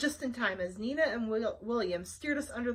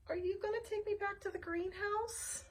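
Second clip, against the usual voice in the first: small voice; 10 semitones higher, 7 dB quieter than usual. Acted. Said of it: "asked Wanda".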